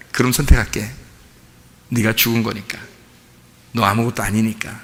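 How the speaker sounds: soft, low voice of God answering; gentle answer. Retold or imitated. God quietly answers the weak believer, low and soft. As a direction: reassure him quietly, like a father.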